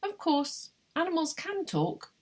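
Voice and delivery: high, lightly pronouncing voice; philosopher client. A narrator's reading. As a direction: pitch it high as the quoted philosopher. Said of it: "something the philosopher Doctor Grosser".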